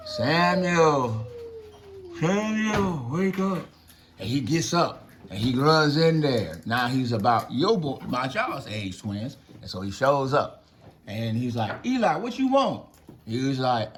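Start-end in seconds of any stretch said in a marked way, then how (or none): 0.1-3.7 a lightly voiced God calling out, drawn out. God calling the sleeping boy Samuel. 11.9-12.9 put-on a higher, eager young boy's question; boy answering. Young Samuel asking Eli what he wants.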